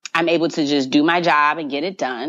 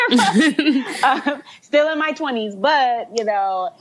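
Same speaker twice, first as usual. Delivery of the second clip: laughing; 8 semitones higher, slower than usual. Real, not acted. Laughing at her own age joke.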